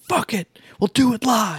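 Bill O'Reilly shout. Retold or imitated. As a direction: quote the famous meltdown. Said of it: "famous clip of him shouting".

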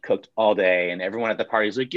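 ordinary delivery

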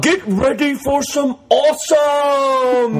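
put-on slow, very high shouted Australian accent. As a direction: belt it out slowly in a hammed-up Australian accent.

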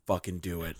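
low, soft goading; menacing dare. Retold or imitated. Daring driver to hit the car, quietly menacing. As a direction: goad him quietly, pitched low.

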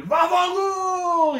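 high, slow, loud Italian scream of Vaffanculo. Retold or imitated. Manny storming into the school foyer, screaming Italian curse. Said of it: "He'd just scream it".

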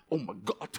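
a low, mocking take on a TV pastor. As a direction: lightly send up a television preacher. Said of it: "You know those pastors on T V?".

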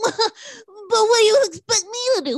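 very high, loud, tearful whining; mock whiny husband. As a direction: imitate a husband wailing in hammed-up outrage. Mocking the complaining husband's reaction.

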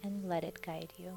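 guided meditation. Acted. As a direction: ease the listener gently toward sleep. Leads a Yoga Nidra meditation.

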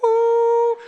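high, loud woo; whooping. Real, not acted. Preacher boasts about relaxing, whooping. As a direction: let out a big high whoop.

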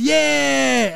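put-on high cheering kids; hammed-up kids' yell; mock kids cheering. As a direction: squeal like excited children. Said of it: "they're like".

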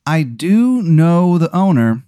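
high, drawn-out teasing offer; Sugar King. Acted. Clown host offering to phone the tire shop owner, high then easing down. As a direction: start high and stretched, settle toward casual. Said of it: "And he goes".

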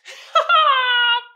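loud high-pitched burst of laughter. Real, not acted. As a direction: crack up hard. Laughing at meme.

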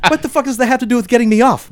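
high, fast indignant retort; snapping back. A narrator's reading. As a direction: snap it back in mock outrage. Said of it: "Matt s-, snapped back".

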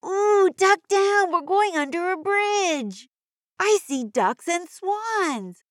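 bubbly high cartoon kid voice; broad singsong kids theatre. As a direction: squeal it like an eager cartoon kid. High cheerful kid character voice.

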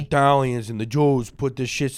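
broad mock-Italian mob accent; Italian wiseguy accent, playing it up; Italian mobster accent. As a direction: do a hammy Italian-American gangster. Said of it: "I was doing Italian".

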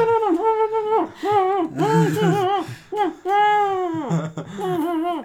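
high wordless trumpet imitation; mock trumpet noise. Joker voicing a trumpet under the taped mouth, mock trumpet noise. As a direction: toot a long wailing mock trumpet line. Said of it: "he's playing trumpet underneath that".

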